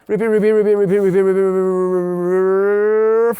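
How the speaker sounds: fast rattled-off repetition running into a blur; stuck-loop imitation. Shown as a demonstration. Producer explains endless repeating, stuck-loop imitation. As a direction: chant it like a machine stuck looping.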